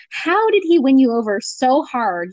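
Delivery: ordinary talk, nothing marked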